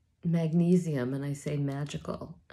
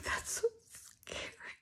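scared whisper; 7 dB quieter than usual. Real, not acted. Scared about covid brain fog.

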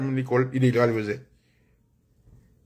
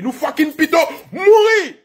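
high acted yell, falling at the end; acted rallying shout; defiant battle cry; 6 semitones higher than usual. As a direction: shout it as a rebel's vow.

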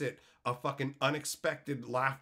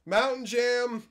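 announcer; 10 semitones higher, 8 dB louder, slower than usual. Acted. Announcing title.

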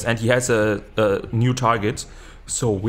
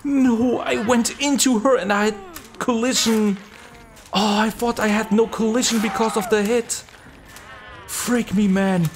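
high-pitched dismay; 10 semitones higher than usual. Real, not acted. Still upset over the collision.

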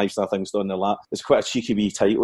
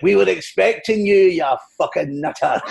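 raised, drawn-out comic insult; Billy Connolly impression; 6 semitones higher, 3 dB louder than usual. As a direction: needle a film star as a mimicked comedian. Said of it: "looking for Billy Connolly".

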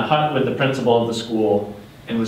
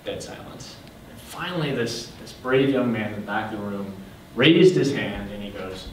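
ordinary delivery

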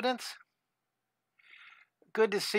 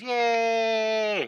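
cheer; 4 semitones higher than usual. Shown as a demonstration. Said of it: "We're ready to draw".

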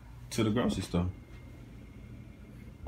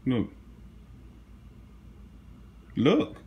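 look, building from plain to loud and high; teasing reveal that grows to a shout; rising teasing build; building excitement; 4 semitones higher, 4 dB louder, much slower than usual. Real, not acted.

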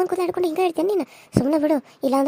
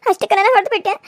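sobbing complaint; 4 semitones higher than usual. Acted. Wife sobbing after the slap.

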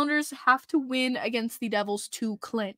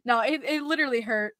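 ordinary talk

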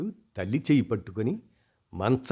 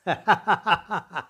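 high, loud peals of laughter; hearty laughter; 9 semitones higher, 4 dB louder than usual. Acted. Mother laughing at her daughter's motherly fuss. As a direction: burst out laughing freely. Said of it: "పకపకా నవ్వింది".

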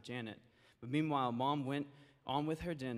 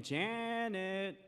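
higher, sing-song call of the name; 7 semitones higher than usual. Acted. Mother calling her daughter again, calling out. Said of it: "So she called her again".

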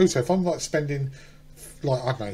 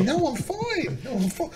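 much higher, tight mock calm; high strained voice; 10 semitones higher than usual. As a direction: squeak it out, pretending all's fine. Acting out stomach after eggs.

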